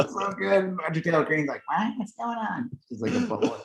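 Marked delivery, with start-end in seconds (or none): 1.7-2.7 mock bewilderment. Imitating bewildered politician caught off guard.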